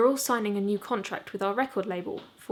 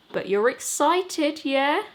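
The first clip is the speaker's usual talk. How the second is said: higher, louder, slow hopeful ask; eager nudge; 6 semitones higher, 5 dB louder, much slower than usual. Acted. Lister checking Jimmy is excited, hopeful and loud. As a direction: coax a yes out of him.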